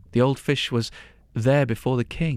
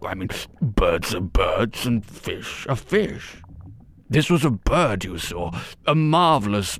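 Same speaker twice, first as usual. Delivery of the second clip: stammering, lower fish courtier voice; a flustered servant, slightly lower; nervous chamberlain; 4 semitones lower than usual. Acted. Chamberlain nervously answers king.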